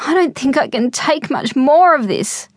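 put-on a bored boy groaning to be let out; whiny boy. A narrator's reading. Restless boy whining to his family in the taxi. Robert complaining in cramped taxi.

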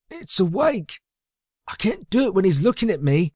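high-pitched whining henchman; high, reluctant underling; nervous sidekick. A narrator's reading. Magnus balks, nervous of baby.

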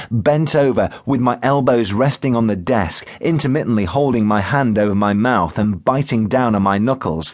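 ordinary delivery

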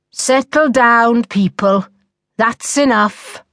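slow, mildly stern order; drawn-out teacherly reprimand. A narrator's reading. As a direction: bring the class to order slowly. Glaring teacher quiets the class.

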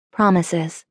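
usual speaking voice

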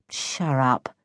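low, soft shut up; subdued, grumpy comeback; sullen retort. A narrator's reading. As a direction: brush off the insult under your breath. Boy snubs friend's insult.

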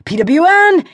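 high, loud gamer exclamation; crowing sign-off; triumphant boast. Acted. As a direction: punch the letters up high. Gamer boast closing blog post.

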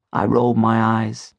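usual speaking voice

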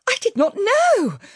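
very high, panicked protest; distressed cry. A narrator's reading. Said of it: "the Memsahib cried".

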